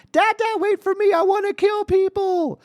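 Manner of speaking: very high, loud child imitation; daughter's eager plea. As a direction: call out in a squeaky little-kid voice. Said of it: "she's giggling and laughing the whole time".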